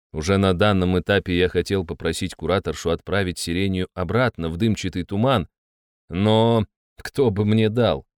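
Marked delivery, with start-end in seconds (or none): none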